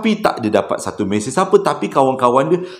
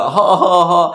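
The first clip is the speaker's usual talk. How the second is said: mock laughing ha ha ha, pitched higher; old man's laugh; 4 semitones higher, 4 dB louder than usual. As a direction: laugh it out like the cabbie. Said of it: "Pakcik tu gelak".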